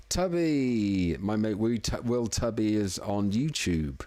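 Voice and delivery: ordinary delivery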